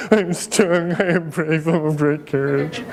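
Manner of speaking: hammed-up whimpering child, words smeared by mock sobs; tearful whining. Crying boy answers his teacher's question, mock tearful whine. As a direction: imitate a small boy blubbering through a recital. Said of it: "through his tears and whining".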